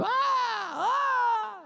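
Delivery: high mock moaning in labor; hammed-up groans of women in labor. Preacher mimicking women moaning in labor. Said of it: "all laying in there together going".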